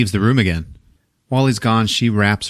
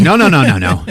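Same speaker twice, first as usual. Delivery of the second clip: louder high rapid no's; frantic refusal; 4 semitones higher, 6 dB louder than usual. Retold or imitated. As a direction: clutch the baby, refuse him. Mother refusing to hand baby.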